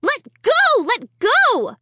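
high, loud repeated yell; pleading desperately with the dummy; panicked shouting. A narrator's reading. The boy still shrieking at Slappy to let go.

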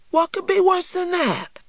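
put-on very high little-boy voice; Christopher John. A narrator's reading. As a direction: ask it high, like a small child. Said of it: "asked Christopher John".

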